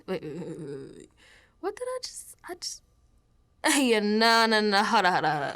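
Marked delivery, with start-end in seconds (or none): 0.1-2.8 soft, much quieter stop-and-check; startled hush. Acted. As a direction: catch yourself mid-thought, alarmed. Catches herself saying creeps.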